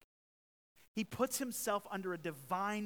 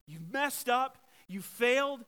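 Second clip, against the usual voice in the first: higher, louder condemning reproach; 7 semitones higher, 10 dB louder, slower than usual. Retold or imitated. Imagined Jesus condemning the sinner, loud reproach. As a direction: scold like a stern judge. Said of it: "we're gonna see condemnation".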